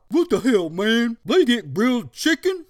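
indignant dog voice. Acted. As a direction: complain like a jealous dog.

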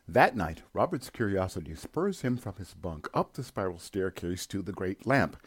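ordinary talk

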